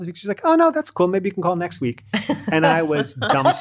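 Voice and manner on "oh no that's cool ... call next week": high, light imitation of an unbothered wife; breezy reassurance. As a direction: wave it off cheerfully, higher-pitched. Retells wife's easygoing reply.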